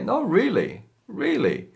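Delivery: high, emphatic insisting; insistent no really. Shown as a demonstration. Teacher insisting a friend believe her, emphatic and high. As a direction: assure them it's true. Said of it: "you wanna say".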